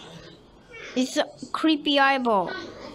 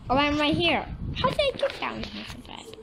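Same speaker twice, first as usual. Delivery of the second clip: normal talking voice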